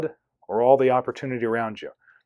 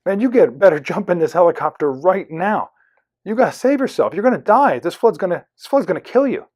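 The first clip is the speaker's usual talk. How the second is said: high-pitched, urgent acted warning; alarmed rescuer; 5 semitones higher, 5 dB louder than usual. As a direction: frantically urge him to save himself. Helicopter rescuer warns of drowning.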